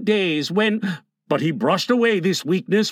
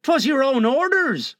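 high-pitched nervous protest; anxious henchman, voice pitched up; faltering; 8 semitones higher than usual. A narrator's reading. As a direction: protest uneasily to the captain. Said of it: "the boatswain faltered".

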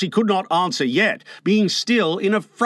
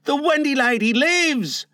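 high-pitched proclamation of good news; astonished, high exclamation; excited cry; 10 semitones higher than usual. A narrator's reading. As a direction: call out the joyful news in a high voice. Announcing that Wendy lives.

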